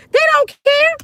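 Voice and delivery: indignant squeal. Real, not acted. The speaker protesting to her viewers, incredulous high squeal. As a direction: squeak it out in disbelief.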